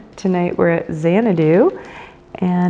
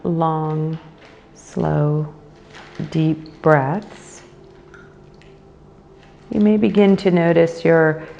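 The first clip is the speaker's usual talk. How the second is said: guided meditation. Acted. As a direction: guide them into the breath, unhurried and measured.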